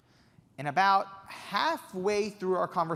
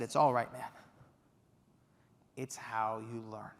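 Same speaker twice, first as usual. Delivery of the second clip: reassuring; 5 semitones lower than usual. Retold or imitated. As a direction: ease him down gently. Quincy calming upset young man.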